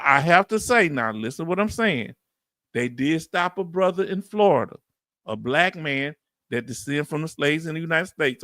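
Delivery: ordinary delivery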